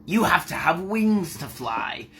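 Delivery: slow, haughty storybook bird; scornful seagull. Acted. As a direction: scoff slowly at a lowly rodent. Voices scoffing seagull in fairy tale.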